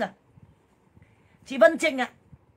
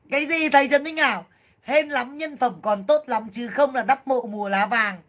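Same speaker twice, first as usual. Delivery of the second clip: ordinary talk, nothing marked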